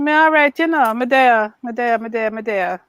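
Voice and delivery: put-on playful light Jamaican accent, me there; slipping into a teasing Jamaican lilt. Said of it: "Your Jamaican accent having you".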